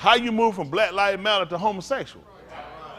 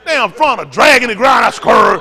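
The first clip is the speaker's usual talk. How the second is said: loud hammed-up yell of disgust; mock shout; 3 semitones higher, 12 dB louder than usual. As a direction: bellow it out like a mock alarm. Mocking, shouting.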